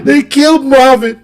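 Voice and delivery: high, loud, grieving cry; mother telling her son through tears. Retold or imitated. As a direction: wail out the name. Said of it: "And she was crying".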